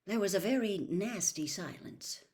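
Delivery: ordinary delivery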